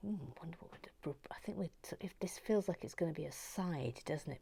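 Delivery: fast concentrating whisper; unvoiced working whisper. Real, not acted. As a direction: murmur while focused.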